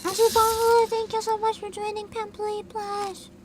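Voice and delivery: bright, high thank-you; excited thanks. Real, not acted. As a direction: greet them with a burst of excitement.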